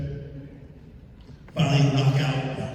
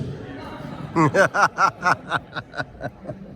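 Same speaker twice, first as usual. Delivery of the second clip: big laugh; 4 dB quieter than usual. Real, not acted. Laughing at memory.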